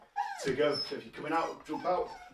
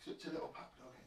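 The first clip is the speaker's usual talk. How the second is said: whispered to the dog; soft aside whisper; 4 semitones lower, 16 dB quieter than usual. Real, not acted. Murmuring to the dog.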